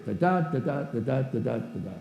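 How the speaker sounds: steady da da da beat of regular verse. Shown as a demonstration. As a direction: tap out the regular iambic rhythm. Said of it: "sort of regular verse".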